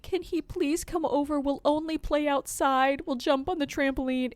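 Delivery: put-on high, pleading little boy voice; mock kid begging to play outside; imitating her son. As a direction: beg like a small kid. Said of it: "Lennox was like".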